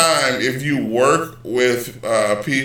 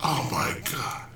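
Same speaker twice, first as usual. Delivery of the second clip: hushed, voiceless disbelief; appalled whisper; 2 semitones higher, 11 dB quieter than usual. Real, not acted. Host appalled by the endlessly repeated commercial, whispering. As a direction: whisper it, as if aghast.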